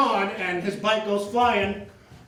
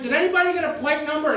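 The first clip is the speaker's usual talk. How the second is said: angry call-out; 7 semitones higher, faster than usual. Retold or imitated. Furious victim calls out.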